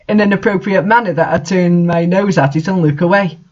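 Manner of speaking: usual speaking voice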